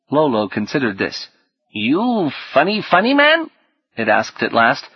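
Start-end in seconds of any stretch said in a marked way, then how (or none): 1.7-3.5 put-on lizard's kid speak. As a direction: swoop upward as a puzzled little reptile. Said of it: "so sunk in kid speak".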